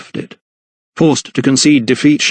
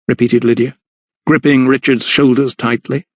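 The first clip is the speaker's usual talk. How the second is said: ordinary delivery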